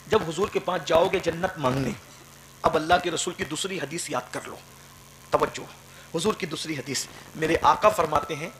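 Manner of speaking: ordinary delivery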